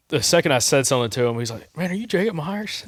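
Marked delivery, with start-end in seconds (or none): 1.8-2.8 surprised. Retold or imitated. Surprised fan recognizes him.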